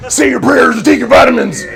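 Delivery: big, high, loud wrestler promo yell; booming high pro-wrestling catchphrase; Hulk Hogan shout. Retold or imitated. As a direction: belt it out like a showman wrestler on the mic. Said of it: "Hulk Hogan was like".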